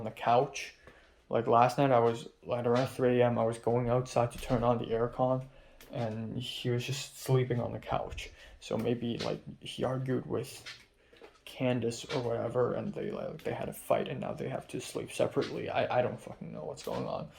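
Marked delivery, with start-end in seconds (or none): 5.9-17.3 dropping to a near whisper about the roommates; hushed gossip. Real, not acted. Telling the camera about roommates fighting, hushed near whisper.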